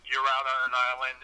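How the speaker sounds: ordinary delivery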